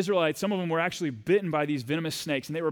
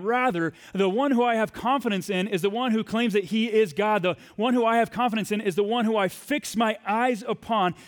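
preaching at full force; 5 semitones higher than usual. Acted. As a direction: testify it with full conviction. Preaching confidence in God.

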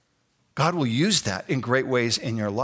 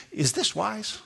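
"Is this wise" pitched high, a little softer, as a question; questioning inflection; 7 semitones higher, 4 dB quieter, slower than usual. Shown as a demonstration. Pastor giving graduates the question to ask, questioning inflection on "Is this wise". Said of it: "Do you notice my inflection".

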